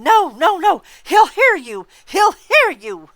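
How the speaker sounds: high, loud panicked shriek, last words slowed; frantic screaming. Acted. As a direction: scream in terror, dragging the end. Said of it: "Agatha screamed".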